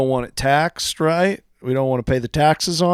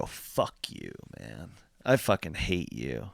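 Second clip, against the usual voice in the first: much softer, nearly whispered curse; hushed defiance; 12 semitones lower, 13 dB quieter, slower than usual. Acted. Player cursing the toughest redcoat to his face, hushed. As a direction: curse him under your breath.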